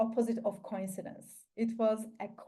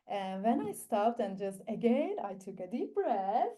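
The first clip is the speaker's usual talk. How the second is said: ordinary talk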